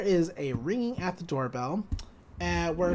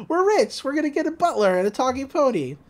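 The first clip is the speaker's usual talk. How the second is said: much higher, louder giddy squeal; excited twins; 7 semitones higher, 4 dB louder, faster than usual. Retold or imitated. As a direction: squeal with kid glee. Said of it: "the twins are excited".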